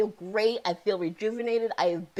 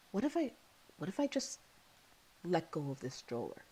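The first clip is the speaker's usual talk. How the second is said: much softer, drifting retold thought; hushed inner thought; 11 dB quieter than usual. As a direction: let the dark thought slip out softly. I remember thinking.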